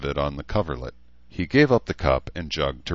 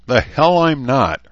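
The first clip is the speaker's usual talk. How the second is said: higher, louder and slow, stubbornly irritated; irritable; 10 semitones higher, 9 dB louder, slower than usual. A narrator's reading. Patient defying the nurse who says he can't get up, irritable and loud. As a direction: snap back, refusing to stay in bed. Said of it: "Herzer replied irritably".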